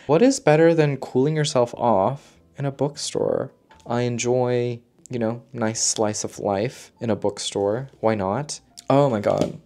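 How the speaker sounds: ordinary talk, nothing marked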